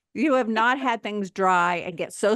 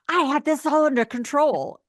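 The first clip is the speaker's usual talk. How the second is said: higher and louder, mock-confident voice; smug self-assurance; 7 semitones higher, 6 dB louder than usual. Mocking her past self's confidence, louder and higher. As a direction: brag like you're sure you've got it handled. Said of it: "I'm like".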